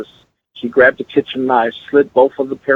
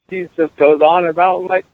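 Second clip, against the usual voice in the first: ordinary talk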